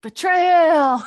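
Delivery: big, high, drawn-out cry; mock-outraged shout. Player protests the break, mock-betrayed shout. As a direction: wail it loudly in hammed-up outrage.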